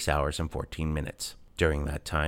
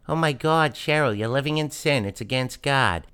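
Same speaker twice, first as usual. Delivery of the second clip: mocking his mother; 8 semitones higher, 5 dB louder than usual. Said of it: "the conversation went something like that".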